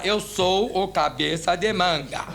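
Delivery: "Eu sou o cabeça de manga" drawn out at half speed; slow, emphatic "Eu sou o cabeça de manga"; dictated phrase. Retold or imitated. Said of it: "agora o senhor vai falar assim".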